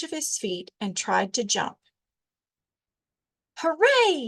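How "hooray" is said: high, loud joyful yell; excited cheer; yelled hooray. A narrator's reading. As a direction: cheer out with delight. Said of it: "he yelled".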